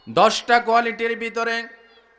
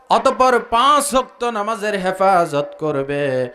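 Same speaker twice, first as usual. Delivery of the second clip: sing-song preaching; 9 semitones lower than usual. Acted. Preacher listing qualities for the congregation, chanted preaching. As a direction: intone it on a low, level note.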